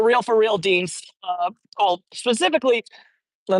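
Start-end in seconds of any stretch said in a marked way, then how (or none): none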